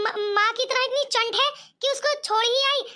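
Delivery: normal talking voice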